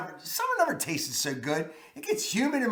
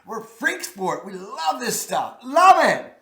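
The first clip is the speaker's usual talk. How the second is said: higher, louder burst of love; gushing; 4 semitones higher, 4 dB louder than usual. Real, not acted. Host gushing over Old Bay chips, louder and higher. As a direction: blurt out how much you love it.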